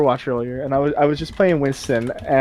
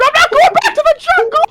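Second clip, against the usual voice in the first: much higher, louder scream; 4 dB louder than usual. Retold or imitated. Speaker screaming back to teammate, high shriek. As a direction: shriek it like a freed monkey. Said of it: "And I just screamed".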